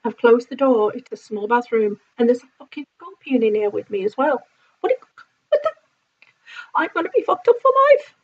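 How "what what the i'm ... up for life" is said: very high, squealing panic; panicked squeal. Real, not acted. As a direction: shriek it like you're trapped with a scorpion. Reacting to scorpion in bathroom.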